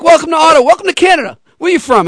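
high, upbeat host welcome; mock-grand welcome to Canada; welcoming celebrity. Retold [name] welcoming him.

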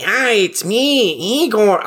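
high, drawn-out cartoon henchman; Igor voice. Host demonstrates an overused cartoon trope voice, high slow Igor impression. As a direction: stretch it out like a stock horror sidekick. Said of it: "you know the, uh, the Igor voice".

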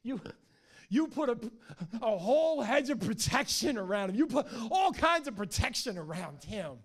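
high, loud, stammering protest; hammed-up indignant whine, building into a tantrum; loud whining. Satan protesting God's hedge around Job, whining loudly. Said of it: "he whines to God".

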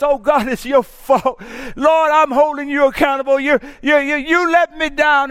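high and loud, blaming God; accusing cry. Retold or imitated. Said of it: "she's screaming and she's yelling".

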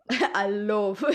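put-on LOVE with an odd accented vowel, through laughter; mock the botched LOVE, amused; mimicked mispronunciation. Teasing friend's failed accent, mimicked mispronunciation of LOVE. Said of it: "one word that you couldn't say right".

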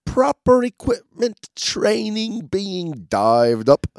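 high, swooping Mary Poppins style British accent; hammed-up cockney-ish Brit reading; mock British accent. Shown as a demonstration. Reading the dive guide to his cohost in a Mary Poppins British accent.

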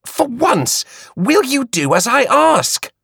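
high, slow, exasperated demand. A narrator's reading. Queenie exasperated with Flora, demanding. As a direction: insist sharply. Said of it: "Queenie demanded".

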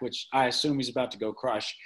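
ordinary talk

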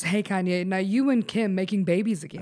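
usual speaking voice

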